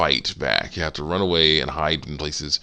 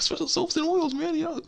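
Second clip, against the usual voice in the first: coaxing walrus cook; 3 dB quieter than usual. Retold or imitated. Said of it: "doing the same thing with this walrus".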